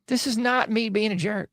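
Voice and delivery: ordinary talk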